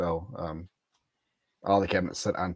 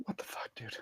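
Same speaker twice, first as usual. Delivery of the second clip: whispered; 17 dB quieter than usual. Real, not acted. Reacting to an awkward request.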